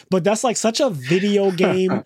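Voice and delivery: ordinary talk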